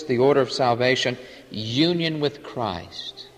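normal talking voice

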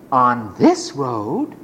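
low, slow, rising wonder; hushed wondering question. Acted. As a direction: drop low and ask it to yourself. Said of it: "same question asked again".

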